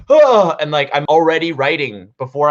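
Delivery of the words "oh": loud, high acted gasp; waking gasp. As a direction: gasp as if jolted awake. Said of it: "like, being like".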